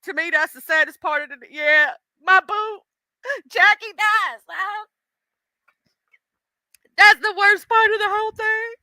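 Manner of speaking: high, wavering mock lament; mock wailing grief. Real, not acted. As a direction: wail over your lost homie. Mourning a game character.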